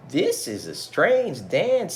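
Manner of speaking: puzzled. Acted. Ming Lo puzzled by dance.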